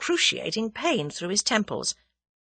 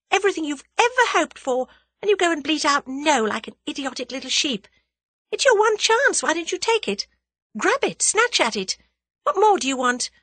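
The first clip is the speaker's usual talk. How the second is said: put-on higher, urgent self-scolding young woman; Tuppence berating herself; 8 semitones higher than usual. Tuppence scolding her own reflection, higher and urgent. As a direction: shake yourself out of it.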